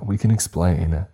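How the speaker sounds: usual speaking voice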